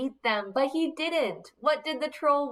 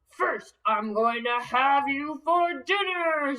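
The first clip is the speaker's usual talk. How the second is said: troll voice; 2 semitones higher than usual. Acted. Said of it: "said the troll".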